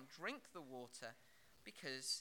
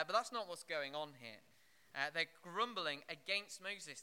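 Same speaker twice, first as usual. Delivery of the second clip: ordinary talk, nothing marked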